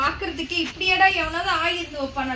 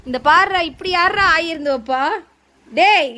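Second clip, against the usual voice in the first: put-on louder, calling the boy to come over; 3 semitones higher, 6 dB louder than usual. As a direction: call him over, mock stern. Mother calls the boy over loudly.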